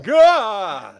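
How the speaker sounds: loud mock-monster "gah"; high, loud toothy-horror yell; horror-movie monster cry. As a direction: blurt out a hammed-up movie-monster roar. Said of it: "It's like a horror movie".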